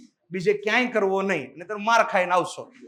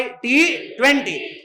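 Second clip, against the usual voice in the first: T twenty drawn out slowly; much slower than usual. Shown as a demonstration. Teacher to class, slow cricket mnemonic. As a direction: say it slowly so it sticks. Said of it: "twenty twenty ક્રિકેટને શું કહેવાય".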